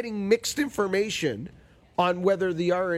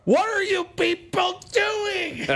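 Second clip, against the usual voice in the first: slow, high-pitched mock-furious shout; exasperated yell; much slower than usual. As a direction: yell it drawn out at the whole committee. Acts out confronting the rules committee.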